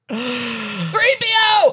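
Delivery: high, slow strained yell; Han Solo impression. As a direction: call out high and drawn. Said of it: "your Han Solo could use some work".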